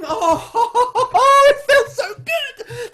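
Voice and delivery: high, loud, slow laughing release; laughing with relief. Acted. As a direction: let the joy burst out in laughter. Relief after shouting secret.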